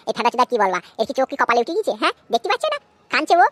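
ordinary talk

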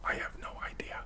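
barely voiced, very soft aside; whispered aside. Real, not acted. Answering the question under the breath, whispered aside. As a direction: drop to a whisper.

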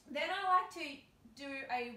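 ordinary talk

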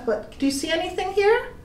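ordinary delivery

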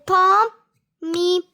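"Pom-", pause, "Mi", each syllable separate; "Pom- Mi" as detached teaching syllables. Shown as a demonstration. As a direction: break "Pom- Mi" into clapped beats. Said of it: "musimy klasnąć trzy razy".

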